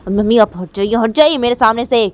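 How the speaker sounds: loud, high order to move aside. Acted. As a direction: shout at mother to get out of the way. Shouting at mother. (Mummy पर चिल्लाकर आदेश)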